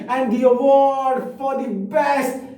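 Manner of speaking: high, hugely drawn-out announcement; award show announcer. Acted. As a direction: build to a loud, held final word. (Award समारोह की खिंची, ऊँची घोषणा)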